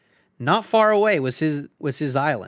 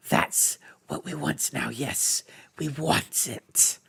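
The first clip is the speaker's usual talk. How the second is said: soft, slow covetous creature muttering; craving the ring, quietly; Gollum voice; 4 dB quieter, slower than usual. A narrator's reading.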